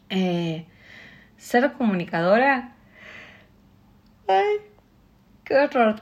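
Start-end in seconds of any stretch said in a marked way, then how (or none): 4.3-6.0 dismayed exclamation. Real, not acted. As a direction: groan of mock dismay. Exclaims at her own yawning.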